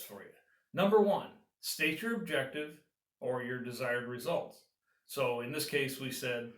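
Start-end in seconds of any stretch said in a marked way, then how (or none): none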